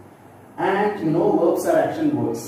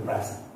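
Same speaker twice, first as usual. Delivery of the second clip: "present" with PRES strong, ending light; weight the PRES in "present"; stress on first syllable; 7 dB quieter than usual. Shown as a demonstration. Teacher explaining the noun, first-syllable stress. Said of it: "so I stressed on".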